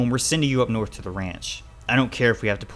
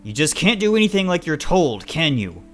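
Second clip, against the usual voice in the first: loud, higher scolding father; raised voice; 8 semitones higher, 10 dB louder than usual. A narrator's reading. Father berating child for disobeying, voice raised. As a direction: raise your voice in exasperation. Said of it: "Dad's voice raises".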